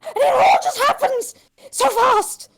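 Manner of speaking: high, fast, frantic lady; frantic noblewoman rant. Acted. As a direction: gabble it in a panic.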